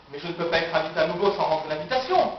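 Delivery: normal talking voice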